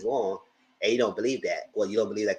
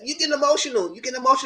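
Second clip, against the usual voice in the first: mocking him; faster than usual. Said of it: "he said".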